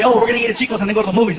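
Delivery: rattled off fast and chirpy; hurried planner. Retold or imitated. Friends planning their night, rushed and chirpy. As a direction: race through the plan like a naive friend. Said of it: "They'll say".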